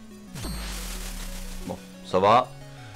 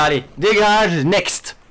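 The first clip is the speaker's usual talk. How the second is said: loud dismissive shout; 7 semitones higher, 7 dB louder, much slower than usual. Real, not acted. Dismissing beaten boss.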